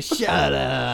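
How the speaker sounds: put-on voice of a cranky trainer; Mickey. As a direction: dismiss him like a grumpy boxing coach. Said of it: "That's how Mickey talks".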